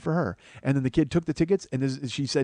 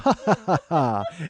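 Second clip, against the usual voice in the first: higher, acted belly laugh; hearty laugh; 5 semitones higher than usual. Husband re-enacting laughing at his own suggestion, hearty laugh. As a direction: put on a big hearty laugh. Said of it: "we all had a hearty laugh".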